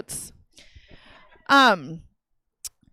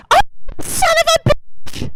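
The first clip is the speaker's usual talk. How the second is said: mother's scream. Retold or imitated. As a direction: imitate a mother screeching out of nowhere. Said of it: "scream to the top of her lungs".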